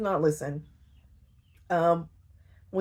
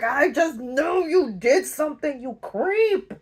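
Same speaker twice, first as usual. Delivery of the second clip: accusing; 7 semitones higher, 3 dB louder than usual. Retold or imitated. She accuses him.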